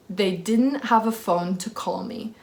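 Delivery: usual speaking voice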